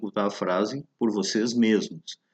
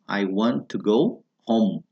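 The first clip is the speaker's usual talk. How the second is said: slow model phrase; 2 semitones lower, slower than usual. Shown as a demonstration. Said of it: "quando se diz".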